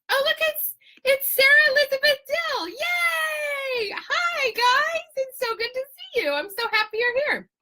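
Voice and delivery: very high, delighted hello; squealing greeting. Real, not acted. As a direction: squeal hello to friends just arriving. Greeting viewers as they join live.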